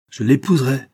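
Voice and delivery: high, loud vow; defiant cry. A narrator's reading. Son defies his father over marriage, crying out. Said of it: "s'écria Gaston".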